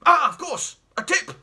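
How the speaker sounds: put-on haughty old explorer, barking at the family; pompous great uncle. Pompous great uncle sneering at the spongers in his house, loud and scornful. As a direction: look down your nose and bellow. Jeremy remembers the tip.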